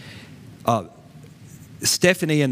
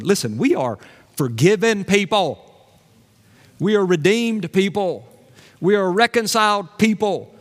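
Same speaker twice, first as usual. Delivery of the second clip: high, emphatic proclamation; rousing preaching; 5 semitones higher than usual. Real, not acted. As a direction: proclaim good news in ringing phrases. Preaches at full force.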